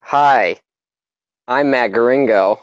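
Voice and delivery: normal talking voice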